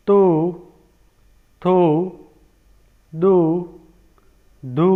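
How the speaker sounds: teacher reciting the syllable chart one syllable at a time, with long pauses; syllable recitation. Shown as a demonstration. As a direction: chant each syllable slowly like a classroom chart. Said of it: "आइए हम पढ़ते हैं".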